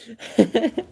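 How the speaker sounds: high giggling burst; squeaky real laugh. Real, not acted. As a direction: laugh out loud at it. Busting up laughing.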